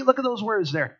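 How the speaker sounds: ordinary talk, nothing marked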